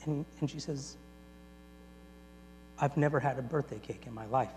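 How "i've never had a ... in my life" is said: soft, low, tender admission; quiet confession. Retold or imitated. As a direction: confess it softly, deeply moved.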